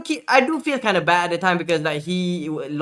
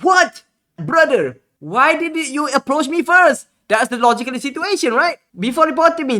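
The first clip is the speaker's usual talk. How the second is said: incredulous outrage; 7 semitones higher, 5 dB louder than usual. Retold or imitated. Streamer confronting the scammer about reporting him, high incredulous outrage.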